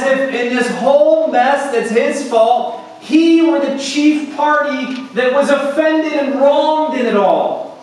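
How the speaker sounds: high, loud, driving sermon; impassioned pulpit indictment; forceful preaching. Acted.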